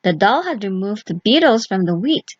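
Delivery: plain reading voice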